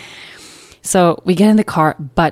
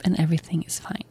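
whisper; 8 dB quieter, faster than usual. Real, not acted. Calm ending after stressful night.

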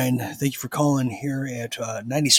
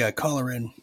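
ordinary delivery

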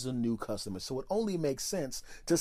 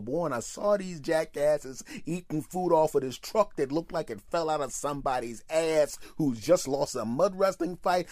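usual speaking voice

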